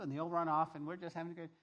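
usual speaking voice

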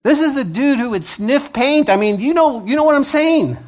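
acted disbelief, pitched high; incredulous. Baffled townsfolk reacting to saved drug dealers, incredulous. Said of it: "We're looking at him like".

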